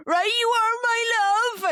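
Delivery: put-on loud, high Cornish accent. Dropping the son at school: loud, high Cornish accent. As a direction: call it out in Cornish to embarrass. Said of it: "And I do a Cornish accent".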